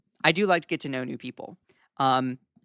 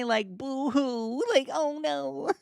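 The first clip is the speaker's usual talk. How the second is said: mock crying; 9 semitones higher, much slower than usual. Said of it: "Are you trying to make me cry?".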